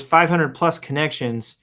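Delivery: ordinary delivery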